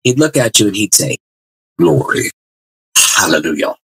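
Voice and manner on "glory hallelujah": imitating an English Methodist preacher, slow and drawn out; lightly put-on English accent, preaching Glory and hallelujah. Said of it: "spoke with a lovely accent".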